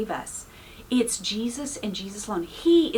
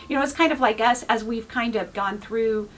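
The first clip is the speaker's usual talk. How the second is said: usual speaking voice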